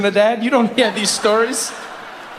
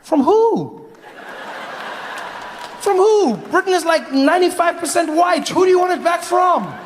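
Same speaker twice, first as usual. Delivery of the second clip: incredulous shout; 7 semitones higher than usual. Acted. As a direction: yell it in disbelief. Comedian mocking Brexit argument.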